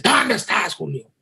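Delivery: high-pitched scolding demand of where he is; angry yelling wife. Retold or imitated. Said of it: "Blanca le puso una gritona".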